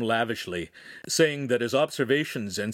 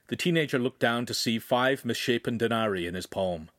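plain reading voice

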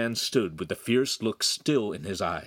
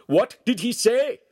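louder, very high question; angry demand; 7 dB louder than usual. A narrator's reading. Master asks friend about apprentice's insolence, angry demand. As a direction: demand it of a bystander, loudly.

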